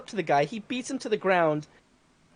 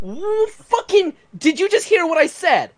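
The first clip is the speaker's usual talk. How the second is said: exasperated disbelief; 8 semitones higher, 5 dB louder than usual. Retold or imitated. Exasperated reply to boss.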